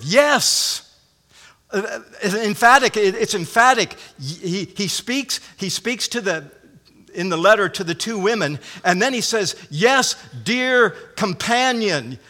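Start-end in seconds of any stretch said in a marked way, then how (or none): 0.0-0.9 YES raised high and loud; emphatic affirmative. Shown as a demonstration. Preacher showing the word's force, emphatic high "Yes". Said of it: "it's a very affirmative". 9.7-12.2 slow, weighty stress on "Yes, dear companion"; emphatic. Acted. Preacher quoting Paul to his companion, slow and emphatic. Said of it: "uh, emphatically".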